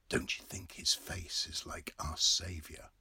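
hushed confiding whisper. Acted. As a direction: lean in and whisper the joke. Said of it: "Red Murray whispered".

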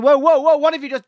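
high, loud startled whoa; alarmed. Retold or imitated. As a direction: pull back in sudden alarm. Retold alarmed reaction.

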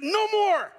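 high, loud and final; commanding cry as Jesus. Acted. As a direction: slam the door shut with it. Jesus clearing the temple.

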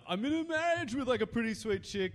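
high, loud goofy dude voice; dopey bro voice. Acted. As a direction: ham up a clueless bro buyer. Plays dumb Xbox buyer role.

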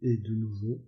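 ordinary delivery

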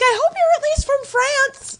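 high, snarky mock voice; high mock retort. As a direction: put them down in a high voice. Quoting her own retort.

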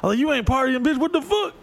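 high-pitched mock outrage; salty trash talk. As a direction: rant in an exaggerated, high, indignant voice. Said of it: "I was a little salty".

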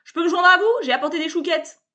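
loud, high, cheerful bluster; brash salesman. A narrator's reading. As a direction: barge in like an overfamiliar hustler. Sébastien's pushy voice.

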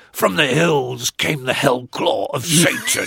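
slow, portentous poetry reading; mock gothic recital. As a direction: intone it like dark verse. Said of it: "this isn't a direct quote".

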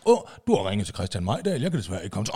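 lavt, let mimet telefonsvarer; mild efterligning af en telefonsvarer; voicemail voice. Retold or imitated. Voicemail of the journalist. (dæmpet telefonsvarerstemme)

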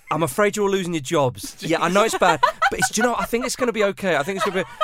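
loud, high, cheery delivery of bad news; Gav. Boss telling staff they're losing jobs, relentlessly cheerful. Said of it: "Big smile".

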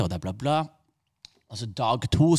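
ordinary talk

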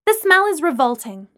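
high, loud insistence; forceful disgusted objection; insistent complaint. A narrator's reading. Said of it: "Lana insisted".

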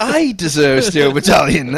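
mocking the entitled customer. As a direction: sneer it.

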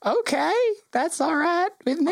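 the GM voicing Potitis, high and slow; high slow character voice. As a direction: drawl it out high and agreeable. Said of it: "Potitis is like".

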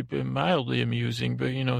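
ordinary talk, nothing marked

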